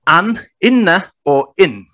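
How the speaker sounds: "an, inna, or in" spaced out slowly, each form distinct; distinct vowelled readings. Shown as a demonstration. Presenter showing how markings change an Arabic word, distinct careful readings. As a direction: set apart "an", two-syllable "inna" and short "in". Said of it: "changes the pronunciation".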